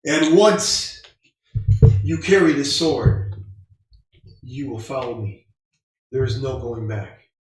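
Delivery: big booming divine proclamation; booming dragon god voice. Acted. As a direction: boom it out like a god. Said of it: "You hear a booming voice".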